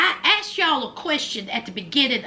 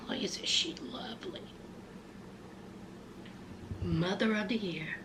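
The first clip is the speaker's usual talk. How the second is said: hushed, dry sarcasm; sarcastic praise; 5 semitones lower, 17 dB quieter than usual. Real, not acted. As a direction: drop it very soft, as a snide aside.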